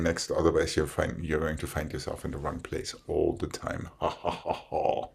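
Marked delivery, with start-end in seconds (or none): none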